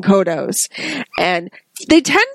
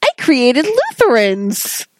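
a much higher gasp on "Oh", then slow, amazed; imitating Lisa; 3 semitones higher, slower than usual. Co-host quotes Lisa discovering Lutherans, in a much higher voice. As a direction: do Lisa, amazed by her own creation. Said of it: "and she's like".

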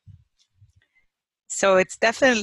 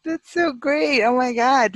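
delighted; 5 semitones higher, 3 dB louder than usual. Real, not acted. Thrilled at shared belief.

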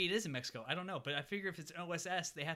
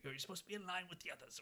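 lightly mimicked character voice, higher and quick; softer, hurried imitation of someone giving orders; imitated Floop; 5 semitones higher, 6 dB quieter, faster than usual. Kid mimicking his captor's voice, lightly put on. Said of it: "mimicking Floop's voice".

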